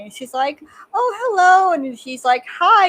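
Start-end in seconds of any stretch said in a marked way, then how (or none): 0.9-1.7 gushing hello. Retold or imitated. Aunt greets Donnie, retold. 2.5-2.9 bright mock hi. Donnie greets aunt, retold.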